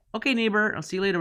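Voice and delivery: high-pitched imitation of a neighbor's goodbye; mock neighborly goodbye. Retold breezy goodbye to the neighbor.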